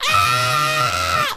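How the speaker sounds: short loud mock scream; imitation of a kid's playful shriek; imitated ticklish scream. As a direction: yell like a tickled child. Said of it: "screaming, as in, like, laughing".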